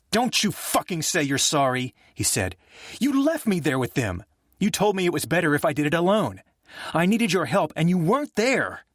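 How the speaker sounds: rising fury, each line hotter; furious outburst. Acted. Jay lashes out at his brother over their father, furious. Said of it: "Jay flung Nick's hand away, his eyes open and burning".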